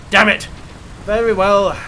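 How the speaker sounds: loud, sharp curse of frustration; frustrated outburst. Real, not acted. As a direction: blurt it out, annoyed. Shot blocked, frustration.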